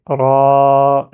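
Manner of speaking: loud, long held "ra"; voyelle prolongée. Shown as a demonstration. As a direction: stretch the a of "ra" right out. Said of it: "je prolonge". (« ra » avec un a longuement prolongé)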